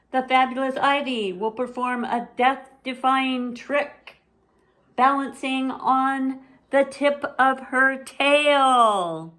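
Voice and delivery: high, loud big-top announcer; a little girl playing ringmaster; showy announcer sister. Zelda announces the trick.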